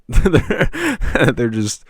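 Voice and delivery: breaking into a laugh. Real, not acted. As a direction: laugh through the word. Laughing at frustrating caller.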